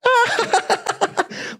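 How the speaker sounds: high, gleeful cackle; maniacal high laugh. Retold or imitated. Cackling while chasing the guy around the poles. Chasing him with piss stream.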